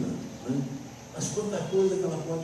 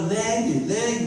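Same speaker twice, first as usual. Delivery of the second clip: high, urgent mock shout; raised-voice demand, imitating a heckler; imitated shout; 9 semitones higher, 4 dB louder, slower than usual. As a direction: call it out high like someone in a crowd.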